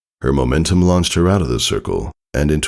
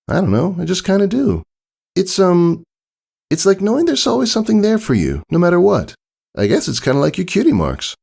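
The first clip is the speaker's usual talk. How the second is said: put-on much higher young filly voice, hesitant; a tomboy filly fumbling for words; Scootaloo, unsure. Scootaloo explaining to her friends why she flies, higher and unsure.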